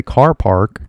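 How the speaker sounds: "car park" with both r's pronounced; American accent. Shown as a demonstration. Teacher imitating a differently accented speaker, American accent. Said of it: "if you hear somebody say".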